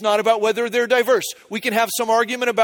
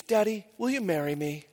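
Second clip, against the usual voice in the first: put-on little girl proposing; 7 dB quieter than usual. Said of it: "She goes".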